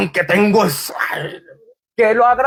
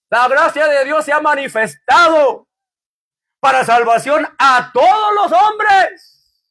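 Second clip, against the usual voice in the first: preaching; 4 semitones higher, slower than usual. Acted. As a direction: preach the grace of God with full force. Reads scripture at full force. (predicando a toda voz)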